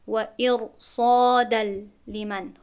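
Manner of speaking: ارصادن very slow, ر full; heavy r recitation. Shown as a demonstration. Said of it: "نمیگویم".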